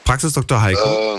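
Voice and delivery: imitating a voice over a phone line; tinny answering-machine greeting. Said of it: "So klang das Telefon gerade".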